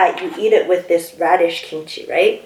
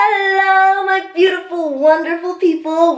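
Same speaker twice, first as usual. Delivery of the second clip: sing-song greeting; 8 semitones higher than usual. Acted. Mukbang host greets viewers.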